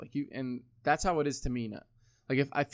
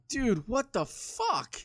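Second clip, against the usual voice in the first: slow, higher, exasperated disbelief; 9 semitones higher, much slower than usual. Retold or imitated. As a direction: drag it out in disbelief. Reacting to physical pain at work.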